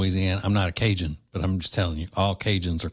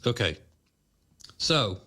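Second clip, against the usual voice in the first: normal talking voice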